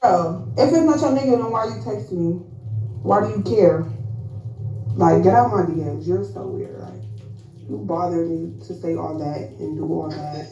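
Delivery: low, mocking gruff voice; mock deep voice. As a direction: growl it down low to tease. Second speaker mocking in low voice.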